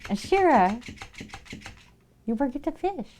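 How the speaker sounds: high, sing-song voice for the pets; pet talk. Real, not acted. Streamer greeting her pets in a high baby-talk voice. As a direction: coo at the animals like babies.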